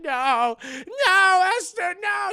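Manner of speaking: high, loud desperate cries; hammed-up terrified protest; acted pleading. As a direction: beg the attacker to stop. Victim begs for her life.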